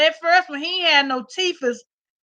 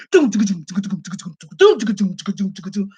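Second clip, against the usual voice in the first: low mock doom doom of a dog running overhead; thudding footsteps; 6 semitones lower than usual. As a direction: thump it out like paws on the floor. Imitating dog running upstairs.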